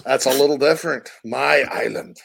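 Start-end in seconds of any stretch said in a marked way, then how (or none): 1.2-2.2 lightly demonstrated Irish lilt; Irish accent. Shown as a demonstration. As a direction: say it with a touch of Irish brogue. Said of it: "Irish is up here".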